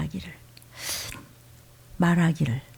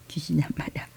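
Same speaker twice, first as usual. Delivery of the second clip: low whisper; 6 semitones lower, 12 dB quieter, faster than usual. Real, not acted. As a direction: hiss it as a warning. Whispered punchline.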